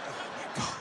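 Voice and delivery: whispered horrified gasp; shocked whisper. Retold or imitated. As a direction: breathe it out in shock. Shocked passengers watching.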